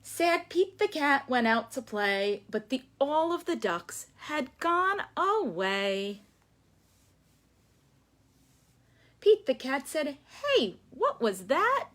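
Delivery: lively sing-song read-aloud; story reading to children. Acted. Teacher reading a picture book to the class. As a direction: read it like story time for little ones.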